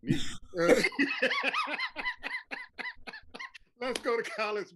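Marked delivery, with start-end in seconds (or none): 0.2-3.1 long high-pitched laughing fit; high cackling laughter. Real, not acted. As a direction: crack up in a high, drawn-out laugh. Co-host cracks up laughing.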